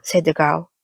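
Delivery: usual speaking voice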